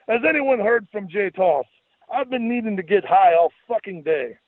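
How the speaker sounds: high, exaggerated Scottish accent; jokey Scottish accent, pitched high; goofy Scottish brogue. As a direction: crude bragging in a silly Scottish voice. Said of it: "stayed ensconced in the Scottish brogue".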